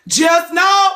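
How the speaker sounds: high shouted warning. Real, not acted. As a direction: call it out loud. Warning the group.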